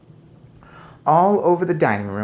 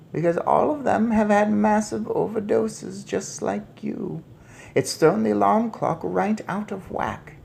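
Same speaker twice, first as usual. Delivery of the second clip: a lightly voiced old grandmother, knowing; 8 semitones higher than usual. Grandmother explaining to boy, lightly voiced. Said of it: "my grandmother said".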